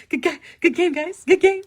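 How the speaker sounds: high, loud, eager congratulations; so happy. Acted. Quarterback congratulating teammates after the game, hammed-up happy excitement. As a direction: cheer teammates on like an overjoyed puppy. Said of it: "he was so happy".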